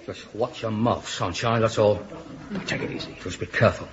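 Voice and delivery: put-on low, soft drunken menace; quietly aggressive. Drunk taster warns the host off his friend, quietly aggressive. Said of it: "Don't get so aggressive".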